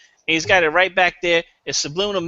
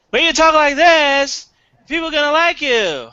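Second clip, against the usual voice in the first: put-on inflection; 9 semitones higher, 4 dB louder than usual. Shown as a demonstration. Comic showing the panel how to talk like a star. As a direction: sell it with a big bright inflection. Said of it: "you just have to put the inflection".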